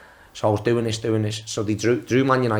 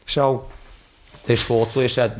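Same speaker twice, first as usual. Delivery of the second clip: ordinary talk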